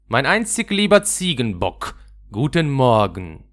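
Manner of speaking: high, loud cheeky greeting; teasing robber girl. Acted. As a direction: tease her mother affectionately. Räubermädchen neckt die Mutter. (freches, lautes Necken der Mutter)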